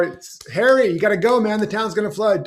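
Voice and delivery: a pushy rescuer at the door; urgent neighbour. Retold or imitated.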